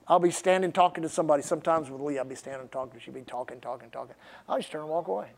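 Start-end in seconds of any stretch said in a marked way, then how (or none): 1.4-5.3 very soft, low monotone aside; confiding aside. Real, not acted. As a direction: drop right down, as if confessing privately. Sheepish aside confessing a bad habit.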